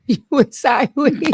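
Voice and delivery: laughing through the words; giggly, high and bright. Real, not acted. Laughing at co-host's slip.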